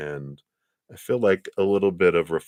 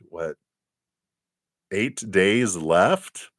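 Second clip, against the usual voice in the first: usual speaking voice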